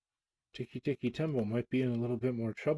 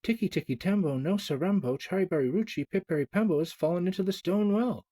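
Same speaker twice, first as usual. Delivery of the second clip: put-on higher, louder call across to the old man; shouting boy; 7 semitones higher, 3 dB louder than usual. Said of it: "shouted Chang".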